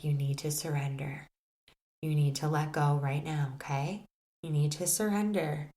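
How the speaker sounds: soft, hushed reassurance trailing to a whisper; calm her down gently, almost whispering; soothing hush. Retold or imitated. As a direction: facilitator softly soothing a panicking participant. Facilitator calming her down.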